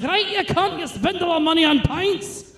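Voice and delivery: high mock Scottish child scolding his dad; squeaky Scottish toddler swearing; Scottish kid cursing. Toddler cursing out his father, high Scottish accent. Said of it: "He was like".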